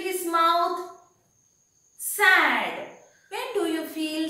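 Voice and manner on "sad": ordinary talk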